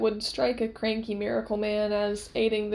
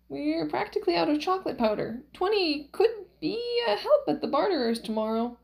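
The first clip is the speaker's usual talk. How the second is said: put-on high, swooping old wife; hint innocently in a high lilt; high-pitched wheedling; Valerie, coaxing; 6 semitones higher than usual. Valerie slyly coaxes Max.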